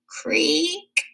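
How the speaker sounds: high thin creaking; door creak. A narrator's reading. Narrator voicing the woodshed door opening, creaking.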